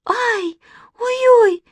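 very high squeals of fright; little girl's shrieked alarm; scared cries. A narrator's reading. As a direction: yelp in panic. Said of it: "закричала Манечка".

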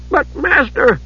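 high-pitched timid protest; protesting servant. A narrator's reading. Monk objecting to his master's order, protesting.

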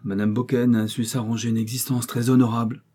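ordinary delivery

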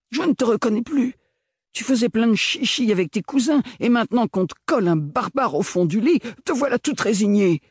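oncle emporté, beaucoup plus haut, véhément; un vieux qui s'emporte contre le pôle; vieil oncle en colère. A narrator's reading. Le grand-oncle reproche. (grand-oncle furieux, voix haute et pressante)

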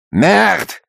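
spat out high angry curse; furious curse. Acted. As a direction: hurl the curse. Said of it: "ещё яростней повторил".

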